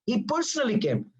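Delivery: "personally" pitched high, then falling away; insistent high stress on "personally"; emphatic personally. Shown as a demonstration. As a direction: lean high on "personally", drop on "came". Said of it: "Emphasizing that the guy came by himself".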